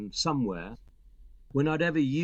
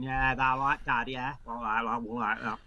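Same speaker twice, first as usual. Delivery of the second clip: put-on light northern accent. Actor recalls chatting in his slight northern accent. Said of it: "I was had a sort of light accent".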